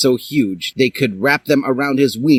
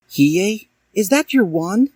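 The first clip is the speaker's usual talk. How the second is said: put-on much higher girl voice; Dally; 11 semitones higher than usual. A narrator's reading. Dally asking Hiei, much higher voice. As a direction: ask wide-eyed. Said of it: "she asked".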